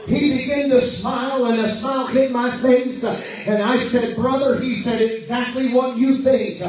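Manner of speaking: fervent revival preacher building his voice to the climax; preaching at full force. Acted. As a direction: preach it out, rising to a roar.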